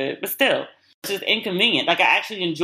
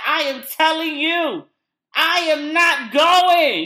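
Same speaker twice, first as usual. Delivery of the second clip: slow, high, hammed-up stubborn declaration rising to a shout; mock Steve refusal; 7 semitones higher, 3 dB louder, much slower than usual. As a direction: drag each word out like a stubborn husband. Steve refusing to leave, voiced.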